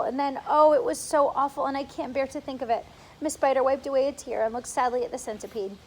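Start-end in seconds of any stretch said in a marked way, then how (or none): none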